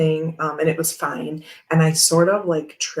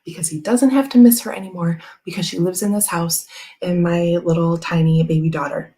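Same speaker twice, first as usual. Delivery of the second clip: ordinary delivery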